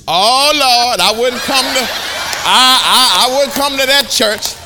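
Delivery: high, loud mock scoff; scoffing stranger. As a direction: laugh it off like a dismissive guy. Stranger rejecting preacher's church.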